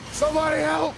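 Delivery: high, loud cartoon yelp; hammed-up high help call; mock cry for help. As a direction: squeal for help like a panicked creature. Mock dragon crying for help.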